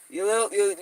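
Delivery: ordinary talk, nothing marked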